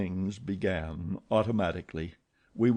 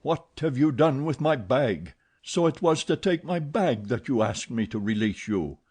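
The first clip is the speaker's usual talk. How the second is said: louder, higher angry repeat; angry demand; 4 semitones higher, 3 dB louder than usual. Acted. Phantom angrily repeating his demand for the bag. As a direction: snap it out, furious. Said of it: "The voice repeated angrily".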